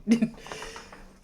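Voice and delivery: breathy high-pitched giggle. Real, not acted. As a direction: let out a light high laugh. Laughing at own sadness.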